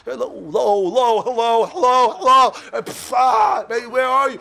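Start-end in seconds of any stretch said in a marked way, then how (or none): 0.1-2.5 slow drawn-out hello, building louder; calling out. Pretend. Rabbi calls to a student whose mind wandered, drawn-out growing call. 2.7-3.7 exasperated yelp. Pretend. Exasperated outburst mid-call.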